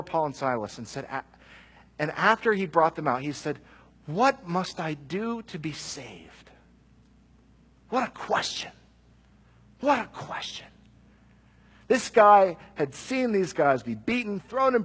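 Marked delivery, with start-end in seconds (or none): 4.1-6.5 hushed plea. Acted. Jailer's desperate question. 7.9-8.7 breathy whisper, higher, in awe. Real, not acted. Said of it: "repeated, whispered". 9.8-10.7 whispered again, higher, full of wonder. Real, not acted. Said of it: "repeated, whispered".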